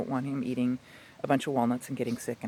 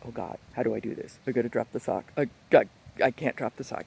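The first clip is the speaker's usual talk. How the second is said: puppy's dilemma; 5 semitones lower, faster than usual. Retold or imitated. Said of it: "he goes".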